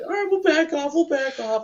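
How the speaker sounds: high, quick mock retreat; backing down. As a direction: imitate them backing off in a high voice. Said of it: "they're like".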